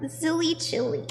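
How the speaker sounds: normal talking voice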